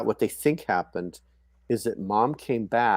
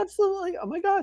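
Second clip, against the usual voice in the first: much higher, breathless joy; overjoyed; faster than usual. Retold or imitated. Mom accepting the baby boy from Jill, squealing with joy. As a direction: let the second yes fly up with delight. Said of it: "I go".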